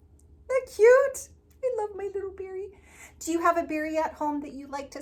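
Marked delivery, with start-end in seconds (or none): 0.1-2.8 gushing. Real, not acted. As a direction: coo over it like a sweet gift. Teacher gushing over gift toy.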